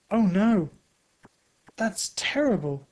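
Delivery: distressed cry. A narrator's reading. Thunder sees his end coming, crying out in distress. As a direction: cry out in dismay. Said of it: "He is crying, shouting".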